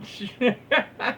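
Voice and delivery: high squeaky burst of laughter; real giggle. Real, not acted. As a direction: lose it at the joke. Laughing at chat.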